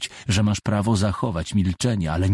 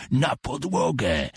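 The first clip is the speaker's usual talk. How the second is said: roar; 5 semitones higher, slower than usual. A narrator's reading. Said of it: "ryknął".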